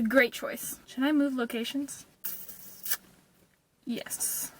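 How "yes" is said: whispered yes. Pretend. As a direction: breathe it out unvoiced. Answers own question in whisper.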